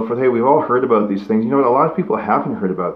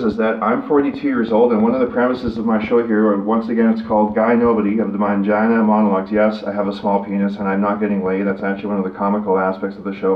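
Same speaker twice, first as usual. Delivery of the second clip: ordinary delivery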